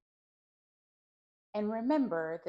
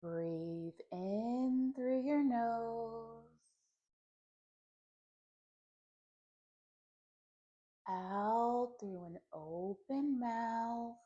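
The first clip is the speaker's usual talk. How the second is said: slow, drawn-out meditation voice with long pauses; guided meditation; slower than usual. Acted. Teacher leading listeners through a clearing breath, slow guided meditation. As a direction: guide each breath slowly, stretching the words.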